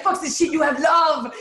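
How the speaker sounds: put-on high, emphatic French-accented exclamation; imitated French accent. Partner's mother insisting she has love, emphatic imitated French accent. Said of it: "She has an accent".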